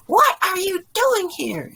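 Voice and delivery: high, loud scream of outrage; screaming woman. A narrator's reading. Woman confronts the groom's father in the hall, screaming. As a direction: shriek it at an intruder. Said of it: "she screams".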